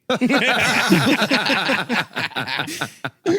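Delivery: big burst of laughter; helpless laughter. Real, not acted. Laughing at own story.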